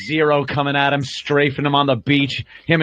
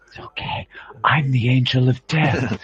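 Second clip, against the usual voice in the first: creepy whisper. Pretend. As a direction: whisper it like a killer soothing his victim. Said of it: "and he's whispering".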